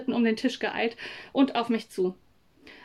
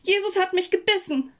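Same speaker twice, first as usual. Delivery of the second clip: viel höher, lauter Aufschrei vor Schmerz; aufschreien, als würde man gebissen; Schmerzensschrei; 10 semitones higher, 4 dB louder than usual. Acted. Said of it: "Ich schrie auf vor lauter Schmerz". (gespielter Schmerzensschrei, Kind am Tisch)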